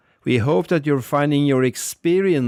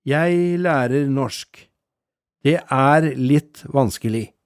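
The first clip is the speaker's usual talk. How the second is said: very slow, every word clear; a teacher models both phrases together, very slowly; slower than usual. Shown as a demonstration. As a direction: lay the phrase out slowly for the listener. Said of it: "Listen again".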